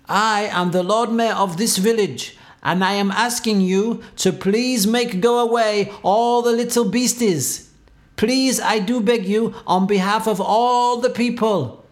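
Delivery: high-pitched, imploring mayor voice; pleading Lord Mayor. A narrator's reading. Lord Mayor begging the cat to help, high pleading.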